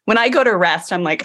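ordinary delivery

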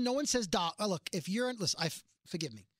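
ordinary delivery

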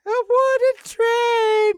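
very high, slow squeaky puppet voice; a creepy clown puppet teasing slowly; squeaky ventriloquist dummy. Acted. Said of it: "this voice when I'm laughing".

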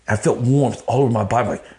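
ordinary talk, nothing marked

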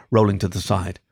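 usual speaking voice

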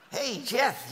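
ordinary delivery

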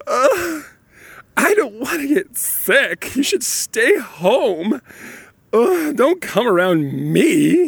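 high, slow, whiny diva voice; dramatized diva. Retold or imitated. Dad dramatizes his daughter refusing to go, slow whiny diva. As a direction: drag it out like a spoiled diva. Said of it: "this is a dramatization of, of how she acted".

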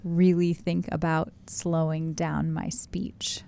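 slow, careful, spaced-out words; slow right down, thinking about every word; exaggeratedly measured pace; deliberately slow. Shown as a demonstration. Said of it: "I'll try to talk slower".

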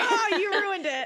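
high laughing shout. Real, not acted. Teasing after the accidental spoiler.

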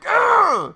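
high, loud mock yell of annoyance; frustrated groan. Man imitating his own reaction to noises, loud groan. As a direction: replay the moment of frustration.

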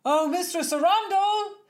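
high, loud call from the landing; loud high-pitched hail from afar. Acted. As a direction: call up the stairs for the mistress. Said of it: "A voice from the landing filled the room".

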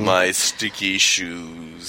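slow, low crooner parody; mock sultry. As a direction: drag it out like a lounge singer. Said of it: "deep, sultry voice".

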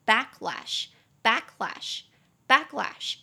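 slow drill of backlash, stressing back; slow word drill. Shown as a demonstration. Teacher drills the new word for students, slow and stressed. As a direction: say backlash slowly, weight on back. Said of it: "两个音节，back".

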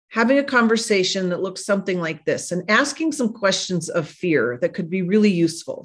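normal talking voice

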